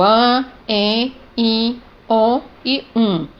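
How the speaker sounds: AN, EN, IN, ON, UM as isolated nasal vowels. Shown as a demonstration. Teacher listing vocalic digraphs, AN EN IN ON UM nasalised. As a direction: list AN, EN, IN, ON, UM through the nose, spaced apart. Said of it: "desempenham a função de uma vogal nasal".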